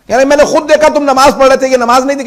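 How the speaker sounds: high, loud scolding cross-examination; stern rebuke. Retold or imitated. Old scholar scolding the young man, loud stern rebuke. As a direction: bear down on him with the accusation. Scholar confronts youth over prayer.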